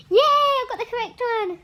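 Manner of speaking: high loud triumphant yay; shrieking jackpot winner. Real, not acted. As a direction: cheer like you hit the jackpot. Winning the round.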